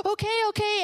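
high, gasping child imitation; nervous little girl. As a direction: mimic a hesitant child agreeing.

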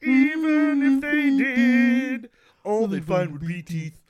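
slow, strained puppet-frog voice; menacing line in a frog puppet voice; Kermit the Frog voice. Acted. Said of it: "so the Kermit the Frog voice".

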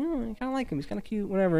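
soft, high, lovestruck mumble; shy crush confession. Retold or imitated. Ruby admitting crush shyly.

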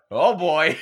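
loud, laughing mock professor; imitating the professor. Retelling the amused professor, loud and laughing. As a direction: belt it out through a laugh. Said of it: "he literally went".

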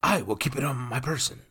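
recovering composure. A narrator's reading.

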